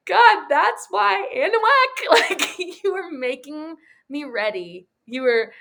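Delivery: emotional laughing, high; laughing realization. Retold or imitated. Ben crying out to God on the ranch, laughing. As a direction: laugh with teary joy at first. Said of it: "he's like".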